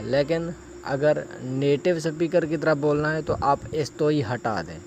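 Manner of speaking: ordinary delivery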